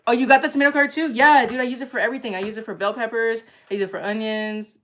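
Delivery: ordinary talk, nothing marked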